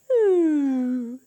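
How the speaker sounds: savoring ooh; a happy eating ooh; delighted ooh. Acted. Teacher to kids, caterpillar tasting food, delighted ooh.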